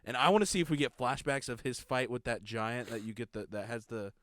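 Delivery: ordinary delivery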